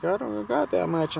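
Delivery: high-pitched protest as her; imitated woman protesting. Woman says she is short of money, imitated high. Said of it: "She's like".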